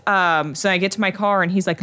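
normal talking voice

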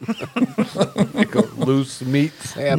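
slow and laughing. Acted. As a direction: laugh through it slowly. Joking echo, laughing.

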